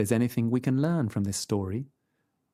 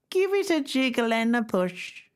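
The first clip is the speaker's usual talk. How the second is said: put-on higher, quavery old lady call; old grandmother; 12 semitones higher than usual. A narrator's reading. Grandmother calling to the door, old and frail.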